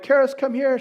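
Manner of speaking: put-on calling a child across the house. Dad calling daughter in story.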